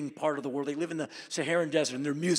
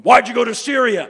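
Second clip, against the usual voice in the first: tough interrogator; 7 semitones higher, 16 dB louder than usual. Retold or imitated. Angry customs officers interrogating.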